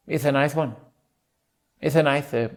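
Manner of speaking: lisped "nice" in a Castilian accent; Spanish trigger phrase, "nice" as "nithe". Shown as a demonstration. Performer finding the accent's trigger, Spanish accent. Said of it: "the triggers for Spanish are".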